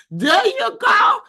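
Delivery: high, loud cheer; a big loud cheer for a chat comment; cheering shout. Real, not acted. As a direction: shout it out like a hype woman.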